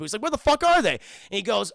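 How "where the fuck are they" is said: higher, louder exasperated demand; loud outburst. Retold or imitated. Obi-Wan demanding where Anakin and Padme are, shouting. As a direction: shout it out in frustration.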